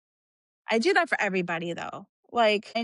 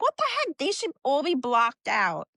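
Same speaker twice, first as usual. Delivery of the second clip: high-pitched mock-incredulous outburst; retold indignant "what on earth"; exasperated disbelief; 6 semitones higher than usual. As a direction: squeal the start, then settle into indignation. Retelling her exasperation at teen matches.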